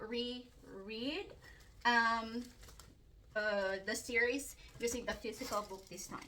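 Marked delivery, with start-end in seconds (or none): none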